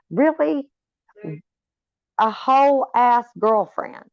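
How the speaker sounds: incredulous disbelief. Retold or imitated. Disbelief at the confused girl meme.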